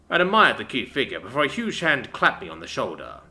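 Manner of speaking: usual speaking voice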